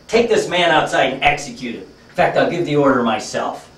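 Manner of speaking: loud imperial command to the generals; acted commander ordering an execution. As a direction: give a loud, forceful order as emperor. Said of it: "Tells his generals".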